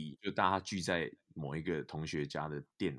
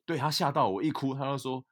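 higher, louder lively recall; animated retelling; 7 semitones higher, 8 dB louder than usual. Real, not acted. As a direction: tell it with a rush of energy. Recalls friend startled by his crying.

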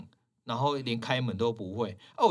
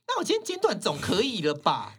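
imitating a fierce customer, higher and louder; fierce snap; 9 semitones higher, 3 dB louder than usual. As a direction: throw the line back at her, fiercely. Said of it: "就这么凶欸". (凶巴巴地顶回去)

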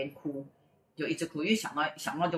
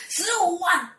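high, loud shriek of disbelief; shocked echo; 14 semitones higher, 5 dB louder than usual. Retold or imitated. Classmate reads the huge fee, acted shocked outcry. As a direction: imitate an astonished friend's outcry.

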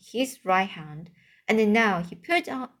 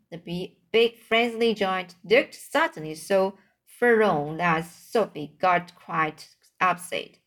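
ordinary delivery, nothing marked

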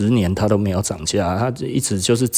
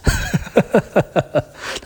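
breathy giggle up high; high airy laugh; 10 semitones higher than usual. Real, not acted. Laughing to viewers about not selling old Supreme, high airy giggle. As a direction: laugh off the buyers' offers.

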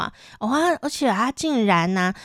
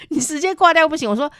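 ordinary talk

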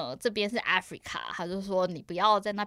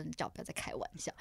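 retold low threat; 3 semitones lower, 9 dB quieter than usual. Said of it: "他就说".

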